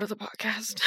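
hushed whisper. Real, not acted. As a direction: whisper the segue. Whispered transition.

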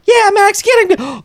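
high shouted cheer; kids cheering. Retold or imitated. Kids urging Max at the cat, shouted high. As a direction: yell like kids egging on a dog. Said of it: "the kids are down there like".

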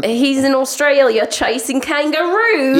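put-on high, slow Australian accent; drawn-out Australian accent. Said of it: "You could do a good Australian accent".

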